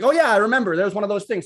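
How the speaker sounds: high, loud burst of realization; sudden excitement. Retold or imitated. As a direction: blurt it out like it just hit you. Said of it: "lightning shoot through me".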